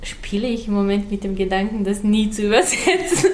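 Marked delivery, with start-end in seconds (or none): 1.8-3.4 chuckling through the words; half-laughed confession; amused, laughing aside. Real, not acted. Laughs admitting she won't translate.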